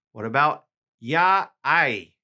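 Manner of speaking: usual speaking voice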